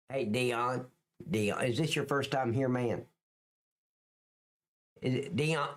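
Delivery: ordinary talk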